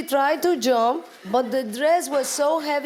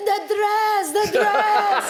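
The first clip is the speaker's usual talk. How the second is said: high-pitched, hammed-up cry of alarm; mock scream; 9 semitones higher, 4 dB louder, slower than usual. Actress imitates Massimo screaming over the dress, mock scream. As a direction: imitate a man screaming in panic over his costume. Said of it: "he's screaming".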